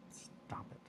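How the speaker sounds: whispered scold. Real, not acted. Crafter whispering at a wiggling wire, annoyed whisper. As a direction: hiss it under the breath.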